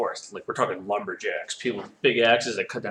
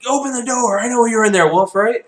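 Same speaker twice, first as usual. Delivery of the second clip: loud, high demand through a door; lumberjack shouting; 12 semitones higher, 9 dB louder than usual. Acted. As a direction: yell to the wolf to open up.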